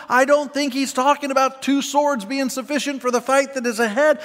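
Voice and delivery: ordinary delivery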